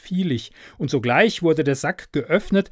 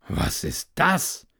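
astonished cry; 4 semitones higher, slower than usual. A narrator's reading. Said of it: "rief der große Klaus".

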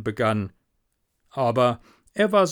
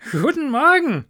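high, loud, delighted greeting; cheerful little Hans; 13 semitones higher, 7 dB louder than usual. Acted. Hans greeting his friend the miller, cheerful. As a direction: ring it out high and happy.

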